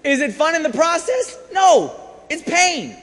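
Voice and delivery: high, loud rhetorical punch; forceful pulpit shout; emphatic preaching. Real, not acted. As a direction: belt out the question and answer it hard. Preacher's emphatic question and answer.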